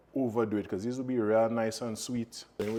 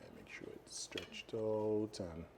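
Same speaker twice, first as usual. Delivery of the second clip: whispering; 3 semitones lower, 13 dB quieter, slower than usual. Real, not acted. Hushed aside while working.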